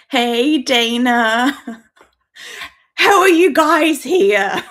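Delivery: loud, high, drawn-out greeting breaking into laughter; delighted disbelief. Real, not acted. Startled streamer greeting viewers who turned up live, loud laughing disbelief. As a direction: cry out in real laughing surprise.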